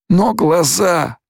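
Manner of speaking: slow, very high protest; distressed follower. A narrator's reading. Stilgar dismayed at Paul's lost eyes, distressed.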